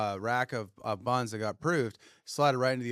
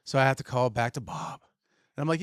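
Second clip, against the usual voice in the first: usual speaking voice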